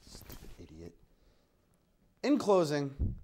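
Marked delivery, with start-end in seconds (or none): 0.1-0.9 very soft, low grumble; muttered insult. Acted. Host grumbling after someone is sent away, muttered. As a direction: curse them under your breath.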